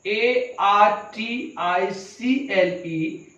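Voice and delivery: slow, letter by letter; measured letter-by-letter spelling; spelled out. Shown as a demonstration. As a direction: spell it out slowly, each letter separate.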